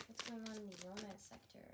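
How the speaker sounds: ordinary talk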